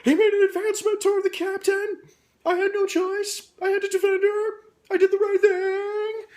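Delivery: high, loud, desperate yelling to justify himself; frantic shout. Retold or imitated. Crazed sergeant justifying the shot to everyone, frantic high shout. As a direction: shout it out like you're pleading your case after a shooting. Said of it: "vocally trying to say out loud and justify himself".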